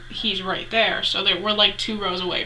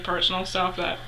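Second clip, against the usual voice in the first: normal talking voice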